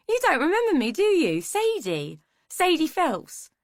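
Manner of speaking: high, loud, swooping woman's voice; showy, high-pitched character voice with big swoops; brash old schoolmate. A narrator's reading. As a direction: remind someone loudly who you are. Woman reminds man who she is.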